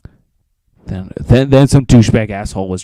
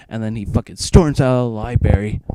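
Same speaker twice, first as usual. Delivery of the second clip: ordinary delivery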